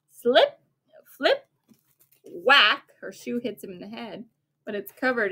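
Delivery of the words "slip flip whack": high and loud comic slip, flip, whack; sound effects. Acted. The parent acts out Cinderella's shoe flying, with loud comic sounds. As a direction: punch each noise like a cartoon.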